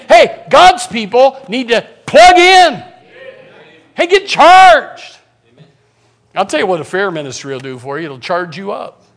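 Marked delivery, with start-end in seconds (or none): 0.1-5.2 loud, slow, drawn-out exhortation; rally them, stretching the key words; rousing call. Real, not acted. Preacher rallying God's people to plug in, loud and slow.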